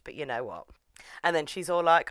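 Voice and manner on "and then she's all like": ordinary talk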